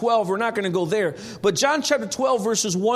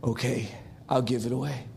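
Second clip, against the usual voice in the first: reluctant surrender; 10 semitones lower, 8 dB quieter than usual. Retold or imitated. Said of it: "that you'll say".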